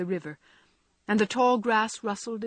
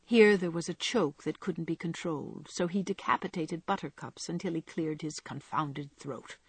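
plain reading voice

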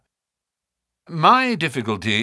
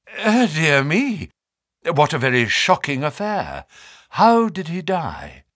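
composed dismay; 5 semitones higher than usual. A narrator's reading. Naturalist covering his shock at the death, studied concern. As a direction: draw out the dismay, then ask carefully.

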